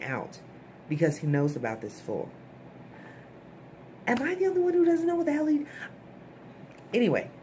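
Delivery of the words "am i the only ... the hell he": squeaky, fast, baffled outburst; much higher, rushed disbelief, like the whole plot lost you; exasperated aside. Real, not acted. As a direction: throw up your hands and squeak it out quickly. Exasperated aside about confusing plot.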